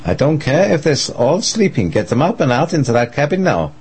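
frantic shouting. Acted. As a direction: bark the orders in a panic. Nervous Dietrich barks orders by phone.

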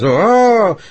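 high, loud howling call; wolf howl imitation. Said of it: "the first one goes".

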